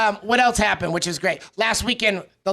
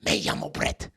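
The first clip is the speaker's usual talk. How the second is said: throaty, softer put-on Spanish accent; mock Spanish-class accent; 6 dB quieter than usual. Teasing how kids spoke Spanish in class, throaty mock accent. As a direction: imitate a student's Spanish accent from the back of the throat. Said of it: "it's always Spanish".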